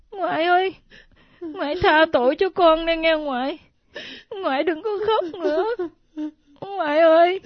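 crying, contrite apology; tearful pleading. Acted. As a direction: beg forgiveness through tears. Grandchild tearfully begs grandmother's forgiveness.

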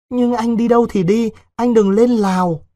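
put-on a frightened girl begging her lover to stay; pleading young girl. A narrator's reading. Vịnh begging Đối not to go to Laos, high and pleading.

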